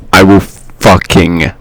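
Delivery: low, slow, booming threat; loud mock threat. Host playfully menacing the interrupters, loud mock threat. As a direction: boom it out deep and drawn out. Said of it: "I could hear you turn your gain up".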